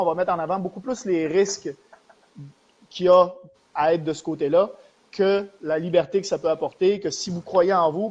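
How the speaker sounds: normal talking voice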